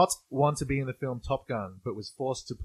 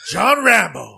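gung-ho soldier; 5 semitones higher, 6 dB louder, slower than usual. Pretend. Playful Rambo impression.

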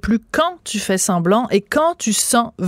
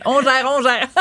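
laughing; 5 semitones higher than usual. Real, not acted. Host echoes guest, laughing.

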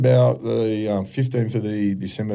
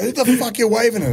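mock alarmed mate; 12 semitones higher than usual. Retold mate's alarmed question.

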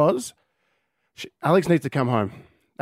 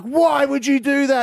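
high, slow, aggrieved question; imitated outraged demand; 12 semitones higher, 3 dB louder, slower than usual. Daughter demands dad explain himself, imitated outrage. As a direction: drag the question out in high indignation. Daughter in retold shouting match.

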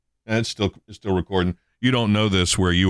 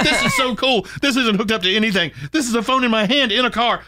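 put-on high, giddy kid voice; silly clown; 12 semitones higher than usual. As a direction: play an excited little kid. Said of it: "and I was sitting there".

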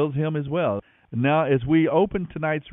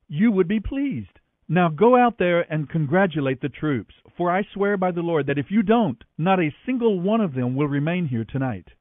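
stern rebuke; 6 semitones higher than usual. A narrator's reading. Joab rebukes the grieving king.

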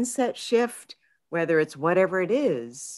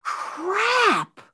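high startled yelp; dismayed crap; 14 semitones higher than usual. Retold or imitated. Learning the package expires next week, high dismayed yelp. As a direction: caught out by the deadline. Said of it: "I'm like".

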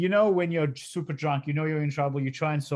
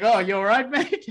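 amused cop; 6 semitones higher, 5 dB louder than usual. Retold or imitated. Cops catch falling drunk man.

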